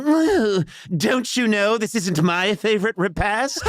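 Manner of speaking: put-on high, slow, prissy kid voice; fussy little boy turning up his nose; prissy child. Son fussily refuses his father's dinner. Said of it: "this kid ... is saying".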